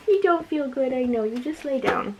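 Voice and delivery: soft, tender comfort to a sick pet; quiet gentle pet talk; soothing the dog. Real, not acted. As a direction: reassure the poor dog gently.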